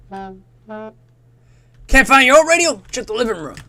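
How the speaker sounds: high, loud American announcer; hammed-up American instructor; American accent. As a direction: call it out in a put-on American voice. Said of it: "like, an American voice".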